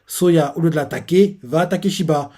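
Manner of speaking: usual speaking voice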